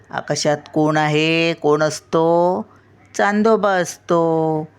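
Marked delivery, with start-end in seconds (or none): none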